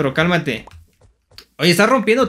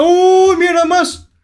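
high, loud cry of surprise; startled exclamation; 13 semitones higher, 8 dB louder, slower than usual. Real, not acted. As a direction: burst out high and loud at a sudden discovery. Startled at finding enemies. (exclamación aguda de sorpresa)

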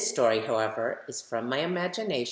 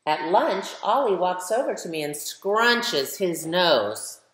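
put-on lively, louder storybook reading for kids; reading to children; 4 semitones higher, 7 dB louder than usual. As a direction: read it like a picture book for a class, brightly. Reads picture book aloud to children.

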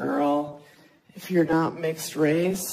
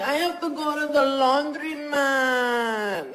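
mock sultry; 6 semitones higher than usual. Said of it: "sounds like they're coming".

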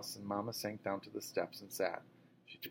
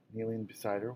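ordinary delivery, nothing marked

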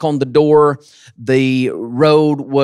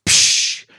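whip crack sound. Retold or imitated. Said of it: "he would pop that whip".

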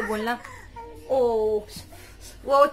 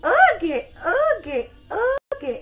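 sing-song coaxing; 9 semitones higher, 5 dB louder, much slower than usual. Real, not acted. Coaxing baby to say okay.

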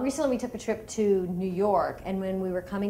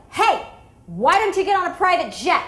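loud, much higher sarcastic snap; angry retort; 10 semitones higher, 10 dB louder than usual. Retold or imitated. Mom snapping at passenger, loud sarcastic retort. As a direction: snap back at the rude stranger. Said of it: "I was like".

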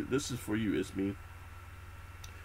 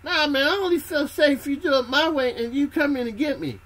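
high, loud, whiny insistence from the sinking man; stubborn scared recruit; 14 semitones higher, 5 dB louder than usual. Retold or imitated. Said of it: "And Bobby tells you".

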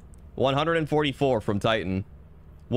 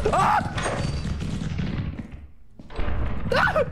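high sudden yelp; startled scream. Real, not acted. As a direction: shriek in fright. Scream at the monster.

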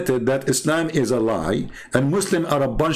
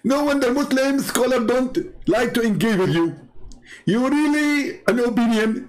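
mocking commenter voice; 10 semitones higher than usual. Reading the commenter Mister Submission's reply aloud, mocking and high. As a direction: sneer his comment back in a higher voice. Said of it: "This is Mister Submission".

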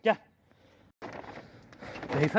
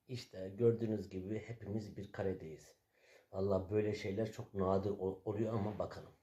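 much softer, lower commentary; quiet aside; 10 semitones lower, 12 dB quieter than usual. Real, not acted. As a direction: murmur to the camera near the dogs. Commenting quietly beside dogs.

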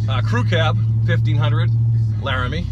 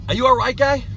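incredulous; 6 semitones higher, 4 dB louder than usual. Real, not acted. Mocking Scotty's claim.

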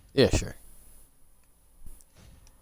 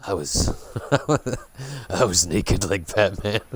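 imitating how he talks; 4 semitones lower than usual. Player teasing him about Batman, low imitated voice. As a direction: lower mock impression, breaking into laughter. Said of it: "he talks like this".

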